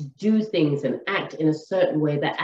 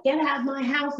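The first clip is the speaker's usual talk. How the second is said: mock scream; 8 semitones higher than usual. Screaming girlfriend throwing her man out, high mock yell. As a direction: act out her yelling without real volume. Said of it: "she's screaming and she's carrying on".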